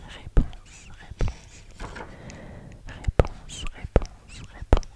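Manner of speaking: réponse repeated in a whisper, French r, nasal on; French pronunciation. Shown as a demonstration. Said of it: "In French we say".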